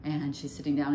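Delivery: ordinary talk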